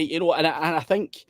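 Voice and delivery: normal talking voice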